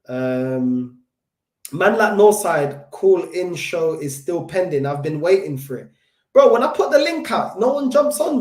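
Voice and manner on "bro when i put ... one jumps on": exasperated complaint. Real, not acted. Complaining nobody joins call-in.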